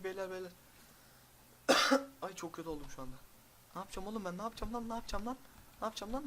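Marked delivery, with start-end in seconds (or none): 3.7-6.3 panicked pleading. Real, not acted. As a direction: beg in rising panic. Panicking over the enemy.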